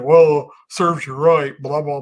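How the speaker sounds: slow, loud, smug jeer at the victims; drawn-out mock scold of a troll commenter; mocking critics. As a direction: sneer it like a heartless critic. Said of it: "people are over here saying".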